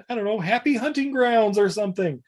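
high, mock-friendly call; hammed-up pleading invitation; coaxing. Retold imposter coaxes fleeing crowd.

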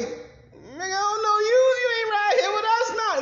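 very high, incredulous mock protest; high-pitched acted indignation, rising to loud; indignant refusal. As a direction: squeal an outraged refusal, hammed up. Acts out refusing the strangers.

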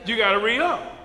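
ordinary delivery

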